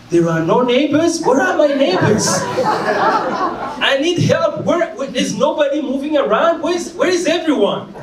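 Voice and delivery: high-pitched cry for missing neighbours; comic, high-pitched wail for help; calling out frantically. Retold or imitated. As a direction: call out in hammed-up alarm, very high. Acts out calling for absent neighbours.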